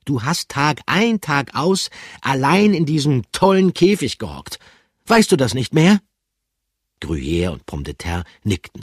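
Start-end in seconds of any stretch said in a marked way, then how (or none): 0.0-6.0 high, loud stern reproach. Acted. Pikandu sternly reminds Bertram. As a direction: lecture him sternly. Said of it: "Pikandu sah ihn streng an".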